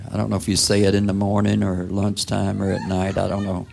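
ordinary talk

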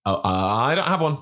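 usual speaking voice

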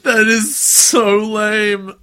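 high, loud squealing protest; excited squeal. Real, not acted. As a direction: wail it out, half laughing. Laughing protest at the picture.

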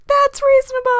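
high, loud mock cheer; crowd cheering. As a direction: call it out like a whole crowd. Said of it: "And everyone was like".

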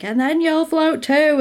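high-pitched mock clown menace; Pennywise. Clown edging closer to the child, high mock menace. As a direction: raise the pitch, imitating a creepy clown. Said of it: "he's sort of edging closer".

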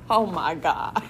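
bursting into a delighted laugh; giddy laughing oh my God; excited laugh. Real, not acted. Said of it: "I'm just so excited".

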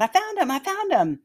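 imitated excited call, high and loud; retold yell. Shopper to searching mother at the store front, yelling. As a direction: call out the good news across a store. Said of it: "someone near the front of the store yelled out".

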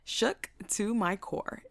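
slow repeat at normal volume; measured emphasis. Retold or imitated. Storyteller underlining how shaken she was, slow and measured. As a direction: spell it out slowly, word by word. Repeats the phrase less loudly.

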